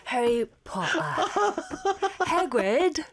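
calling out Harry Potter and Hagrid the British way; British accent. Pretend. Playing Harry Potter calling Hagrid, mock British accent. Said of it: "That's how Harry Potter calls Hagrid".

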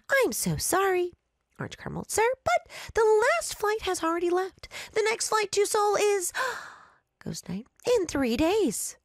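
high sugary apology; sweet clerk voice. Acted. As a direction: smile through the bad news. Cheerful clerk breaks bad news.

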